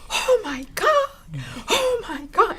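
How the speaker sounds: high, loud half-laughing, half-crying cry of amazement; excited yelling. Retold or imitated. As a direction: yell it out overwhelmed, laughing.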